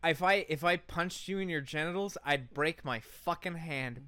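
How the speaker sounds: ordinary talk, nothing marked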